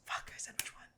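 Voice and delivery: whispered aside, breath only. Real, not acted. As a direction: hush it under your breath. Muttering aside to herself.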